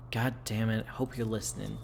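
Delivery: usual speaking voice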